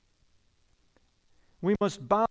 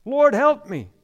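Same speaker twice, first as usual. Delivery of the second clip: loud pleading cry; 5 semitones higher, 9 dB louder than usual. Acted. Woman begging Christ, loud desperate cry. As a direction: call out for help, louder. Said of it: "She cries out".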